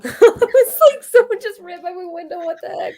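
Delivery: high, loud burst of words through laughter; laughing disbelief. Real, not acted. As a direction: laugh it out, incredulous. Startled by delivery, laughs it off.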